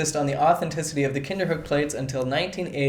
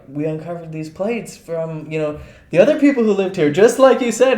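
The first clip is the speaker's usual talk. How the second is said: mock eager finders; 3 semitones higher, 6 dB louder than usual. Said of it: "they're like".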